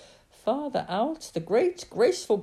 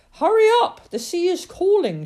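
high, loud pirate mother's shout; urgent, ringing call; 11 semitones higher, 9 dB louder than usual. A narrator's reading. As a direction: shout to hurry someone along. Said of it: "shouted the pirate mother".

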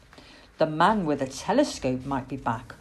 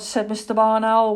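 ordinary delivery, nothing marked

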